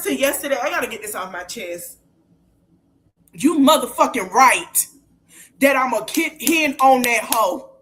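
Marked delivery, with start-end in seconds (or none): 3.3-7.7 loud furious declaration; real angry shout. Real, not acted. Vowing to go after the blogger, real furious shout. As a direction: shout it like a challenge. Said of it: "I'm pissed now".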